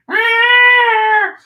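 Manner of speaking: high, loud shriek of the woman on the cover; mock scream. Lady on cover thinking "Ah!.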